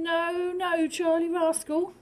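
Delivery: a touch of a high scolding mum. Acted. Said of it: "Can you see Mum saying".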